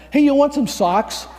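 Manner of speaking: loud, high-pitched call; blurted offer. Retold or imitated. Volunteer offering socks to someone on the street, loud and high.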